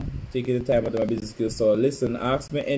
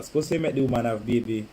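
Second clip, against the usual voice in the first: normal talking voice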